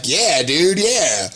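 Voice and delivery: loud, high cheering agreement; hearty agreement. Retold or imitated. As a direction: chime in like an egged-on customer. Customers agree with his complaint.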